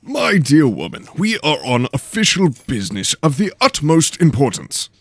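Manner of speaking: a bright, earnest aristocrat; eager nobleman voice. A narrator's reading. Lord Henley's voice.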